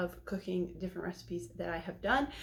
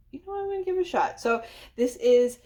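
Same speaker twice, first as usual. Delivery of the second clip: excited; 7 semitones higher, 9 dB louder, faster than usual. Real, not acted. Excited burst before trying.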